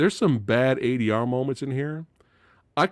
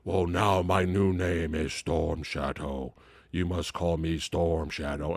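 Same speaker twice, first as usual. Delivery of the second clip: lower, slow dramatic declaration; a hammed-up solemn movie hero; mock hero voice; 9 semitones lower, 5 dB quieter than usual. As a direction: intone it like a brooding ninja. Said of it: "that's when he says".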